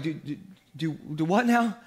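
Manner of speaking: dumbfounded, hesitant comic reply; baffled stammer. Retold or imitated. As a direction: stumble over the first word in mock confusion.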